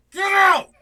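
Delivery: high, very loud yell; mock shout. Church kicking him out, mock shout. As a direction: bellow it to throw someone out.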